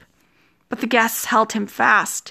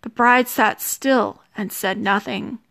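usual speaking voice

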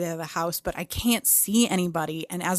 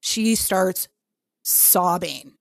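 usual speaking voice

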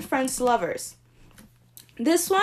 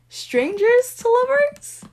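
high, slow, thrilled question; excited squeal; 14 semitones higher, 6 dB louder, slower than usual. Real, not acted. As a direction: squeal the name of a favourite trope. Gushing over favourite book trope.

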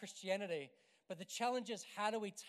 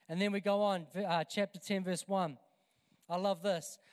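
loud preaching; 6 dB louder than usual. Acted.

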